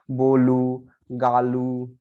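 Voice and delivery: बोलू said with the final ओ as a clear ऊ; Portuguese final o as u. Shown as a demonstration. Teacher showing students Portuguese final o said as u. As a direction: end बोलू and गालू on a pure ऊ sound. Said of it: "लिखते हैं ओ, लेकिन पढ़ेंगे उसको ऊ".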